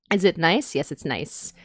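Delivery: ordinary talk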